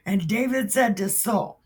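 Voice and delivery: usual speaking voice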